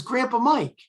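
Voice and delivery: pitched high and strained, a glimpse of the son; son's high insistence. Retold or imitated. Son answers his mother, high and insistent. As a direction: blurt the name up high like the excited boy. Son names the man he saw.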